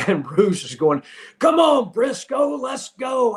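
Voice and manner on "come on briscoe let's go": put-on playful yell to an old friend; shouting across the lobby. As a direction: holler at a buddy to hurry up. Bruce yelling across hotel lobby.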